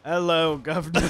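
hammed-up British greeting; British accent. Shown as a demonstration. [name] shows off a British accent, mock British. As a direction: put on a cheeky British accent. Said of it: "do a British accent".

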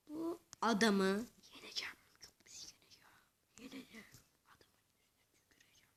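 whispered threat, low and secret; scheming whisper. Real, not acted. Gamer whispers a threat against his rival. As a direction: hiss the plan under your breath.

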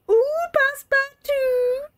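high, slow, drawn-out squeal of delight; excited squeal. Real, not acted. Player thrilled by the romance, squealing. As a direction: squeal the name like a thrilled fan. Reader squeals at character name.

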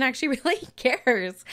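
loud laughing punchline; loud amused reveal through laughter. Retold or imitated. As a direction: laugh it out loud, mock-announcing. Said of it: "I was like".

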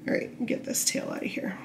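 ordinary talk, nothing marked

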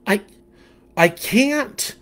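put-on young fish voice.

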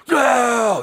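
high, drawn-out cry of refusal; retold scream. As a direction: imitate an injured man screaming. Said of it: "he's screaming".

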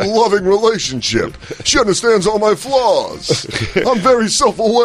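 high-pitched parody of a self-aware comic; Rodney Dangerfield. Acted. Demonstrating how a too-honest comedian would go, hammy parody. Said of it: "let's see how that would go".

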